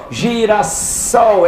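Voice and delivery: Girassol stretched very slowly, hissing the ss; drawn-out demo. Shown as a demonstration. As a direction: show the alveolar s in Girassol. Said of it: "é o alveolar".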